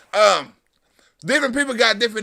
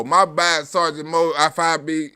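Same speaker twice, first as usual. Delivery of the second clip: ordinary talk, nothing marked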